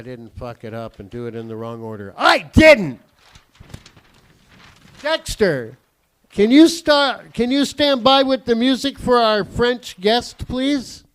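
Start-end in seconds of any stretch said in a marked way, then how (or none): 2.2-3.0 high, loud triumphant cry; triumphant relief. Real, not acted. Performer finds he didn't mess up, triumphant shout. 5.0-11.0 loud, high call offstage. Real, not acted. Host asks Dexter to cue music, loud calling out.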